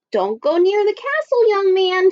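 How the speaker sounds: high-pitched urgent warning; warning old woman. Acted. Old woman warning Jack about the castle, high and urgent.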